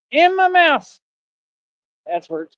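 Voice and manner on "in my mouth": high, loud burst; punched out, much higher and louder; emphatic comic repeat. Acted. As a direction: proclaim it with mock triumph. Comic repeat about food.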